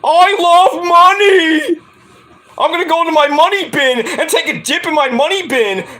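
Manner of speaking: drool over the money bin; Scrooge McDuck. Retold or imitated. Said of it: "Scrooge McDuck's like".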